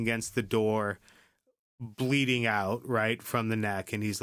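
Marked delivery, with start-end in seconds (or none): none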